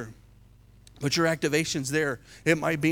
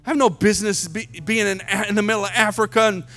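panicked self; 8 semitones higher, 10 dB louder than usual. Retold or imitated. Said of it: "I'm like".

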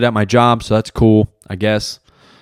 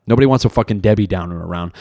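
usual speaking voice

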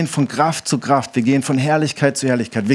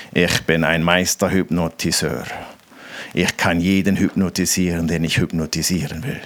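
much lower, grand boast; boastful hypnotist; 7 semitones lower than usual. Retold or imitated. Stranger bragging to the preacher about his hypnosis, low and grand. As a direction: puff up like a master hypnotist. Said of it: "Da sagt er".

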